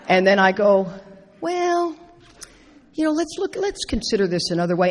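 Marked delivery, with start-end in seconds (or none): 1.4-4.9 a higher, gentle, patient reply; calm and patient. Retold or imitated. Sponsor answers an annoying caller with patient, gentle calm. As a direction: swallow the anger and soothe the caller. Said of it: "And I sincerely mean it".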